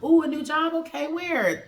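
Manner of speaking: high, bright rising surprise at good news; excited. Retold or imitated. Girlfriend hearing of his new job, excited and high. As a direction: squeal it like happy news. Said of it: "she got excited, like".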